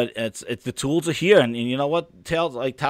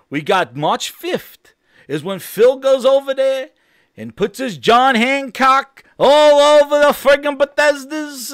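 high, loud excited hype; excited yelling; 9 semitones higher, 5 dB louder, slower than usual. Real, not acted. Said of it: "It was me yelling in the mic".

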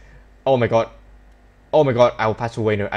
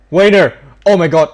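loud, higher cry of the player's name; excited shout; 5 semitones higher, 10 dB louder than usual. Real, not acted. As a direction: yell his name in excitement. Striker chance in match.

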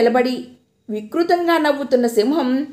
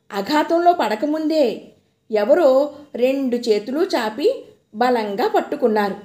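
ordinary delivery

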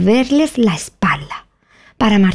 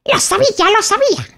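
triumphant cry; 13 semitones higher than usual. Acted. Tweedledum crying out in triumph at Alice, high and wild. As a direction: crow it out in a tantrum of triumph. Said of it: "gritó Tweedledum".

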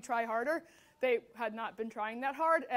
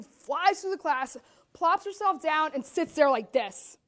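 ordinary talk

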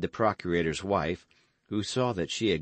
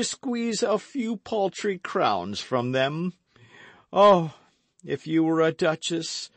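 a touch of proud, wounded Porthos, pitched up; lightly voiced Porthos; 10 semitones higher, 5 dB louder than usual. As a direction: Porthos lecturing her with mock dignity. Porthos reproaching the procurator's wife.

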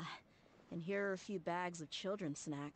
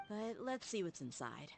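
ordinary delivery

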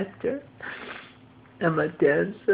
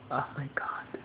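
whispered dismay, softer; shocked whisper; 6 semitones lower, 9 dB quieter than usual. Real, not acted. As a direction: breathe it out in dismay. Shock at bank account. (stunned unvoiced whisper)

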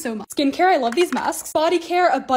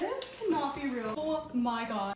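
stunned; 12 dB quieter, slower than usual. Real, not acted. Amazed by gifted cake.

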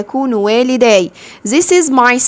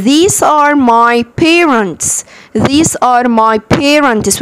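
very slow, carefully stretched English; نطق بطيء جدًا; much slower than usual. Shown as a demonstration. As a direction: stretch every word for beginners. Teacher repeats model sentence. (قراءة إنجليزي بطيئة جدًا)

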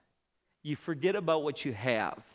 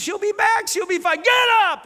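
very high, loud insisting shout; 10 dB louder than usual. Retold or imitated. Wife shouting at husband, very high and loud. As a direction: bark the order to get up. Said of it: "Well, the game is".

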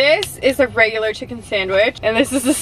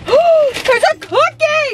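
high gasping delight; excited squeal; 14 semitones higher, 5 dB louder, much slower than usual. Real, not acted. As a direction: gasp and squeal with joy. Finding cookie in bag.

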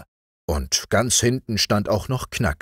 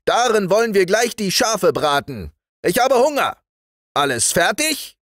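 very high, excited commands; frenzied high-pitched parade-ground orders; wild sergeant; 14 semitones higher, 4 dB louder than usual. Acted. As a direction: whip every troop into action with loud calls. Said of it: "rief der Wachtmeister wild".

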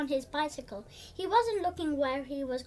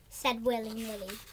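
ordinary delivery, nothing marked

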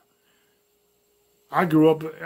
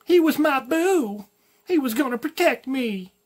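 much higher, slow, put-on girlfriend voice; hammed-up lovesick girl voice; mock girly whine; 9 semitones higher, slower than usual. Mocking abandoned girlfriend.

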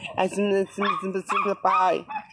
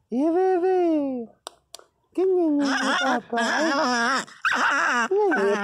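high, slow coo; baby talk; 6 semitones higher than usual. Acted. Cooing over his puppy, high slow baby talk. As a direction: melt over the baby dog.

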